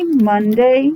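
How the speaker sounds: normal talking voice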